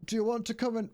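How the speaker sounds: high-pitched cartoon quote; lifted, high character voice; King Julian. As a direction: start the quote in a high, put-on voice. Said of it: "That's not what he says".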